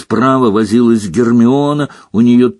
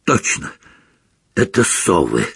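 hoarse; 4 semitones lower than usual. Acted. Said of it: "хрипло сказал Рон".

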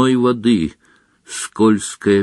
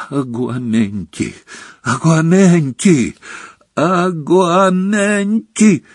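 escalating desperation; 6 semitones higher, slower than usual. A narrator's reading. Harry retrying the spell as Dumbledore fades, escalating desperation. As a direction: spell repeated, climbing higher each time.

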